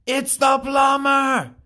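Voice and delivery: high, loud, slow yell. Acted. Plumber yells at the door after no answer, high slow yell. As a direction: bellow it out until out of breath. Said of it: "The man yelled".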